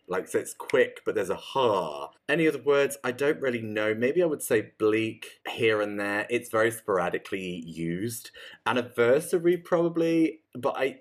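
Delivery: ordinary delivery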